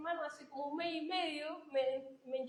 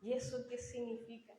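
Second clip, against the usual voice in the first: quiet echo; 4 semitones lower, 8 dB quieter than usual. Shown as a demonstration. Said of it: "repeated for emphasis".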